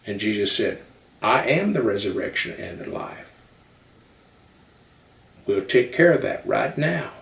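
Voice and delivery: Southern preacher building to a high, loud peak; Southern pulpit fervor, rising to full force. Acted. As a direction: preach to a rousing climax in a Southern accent. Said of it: "but they talk like this".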